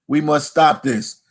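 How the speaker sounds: ordinary talk, nothing marked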